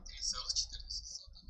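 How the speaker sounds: soft unvoiced whisper. Shown as a demonstration. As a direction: breathe it out as a whisper. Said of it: "mic check whispered".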